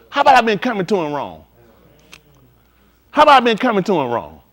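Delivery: ordinary talk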